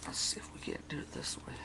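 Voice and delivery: fast unvoiced whisper. Real, not acted. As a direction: whisper it quickly to yourself. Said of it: "whispered aside".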